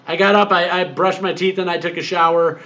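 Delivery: usual speaking voice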